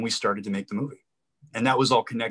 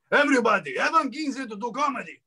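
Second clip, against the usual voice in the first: much higher put-on announcer call; Armando announcing; 13 semitones higher than usual. Party host introducing a surprise comedian to guests, high mock announcement. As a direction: imitate a host hollering to the whole room. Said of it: "so then he got up and said".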